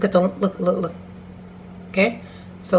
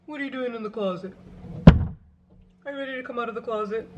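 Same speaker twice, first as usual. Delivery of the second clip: teasing call; 3 semitones higher, 5 dB quieter than usual. Acted. Talking to someone in closet.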